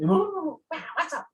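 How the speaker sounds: high quacking duck voice; squawky cartoon duck impression; Donald Duck. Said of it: "how Donald Duck do that".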